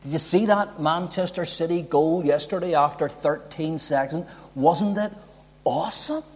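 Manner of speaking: mock a fan gushing over a goal; preacher lightly mimicking a football fan's hype; casual football fan. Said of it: "There's like".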